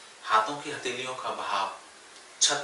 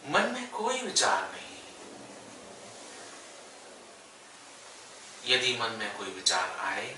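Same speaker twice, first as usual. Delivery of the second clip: unhurried yoga nidra guidance with long pauses; guided meditation; 5 dB quieter than usual. Real, not acted. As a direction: guide them gently into stillness, phrase by phrase. Leads yoga nidra relaxation. (ठहर-ठहर कर योग निद्रा का निर्देश)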